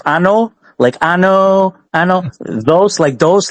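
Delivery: "those" said like the Spanish word for two, dos; slow name pronunciation. Shown as a demonstration. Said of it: "like Spanish say two".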